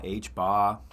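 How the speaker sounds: H bar with dropped r, Boston style; Boston accent. Pretend. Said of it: "say that in a Boston accent".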